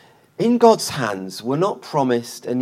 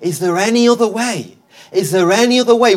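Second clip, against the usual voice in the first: raised, pleading cry, building on the repeat; 6 semitones higher, 5 dB louder than usual. Retold or imitated. Preacher voices Jesus praying in Gethsemane, crying out. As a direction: act out a plea that grows higher, louder and quicker. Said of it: "he's crying out to his Father".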